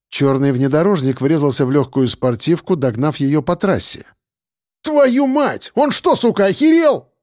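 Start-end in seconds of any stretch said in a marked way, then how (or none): 4.8-7.1 high-pitched furious cursing; outraged swearing. A narrator's reading. Driver fighting the wheel after being rammed, outraged swearing. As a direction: curse in shocked outrage. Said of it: "выругался Белобрысый".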